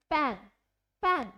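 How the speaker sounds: पैन with a short light vowel. Shown as a demonstration. Teacher models a short vowel in पैन for students. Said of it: "ज़्यादा दबाव नहीं दिया जाता है".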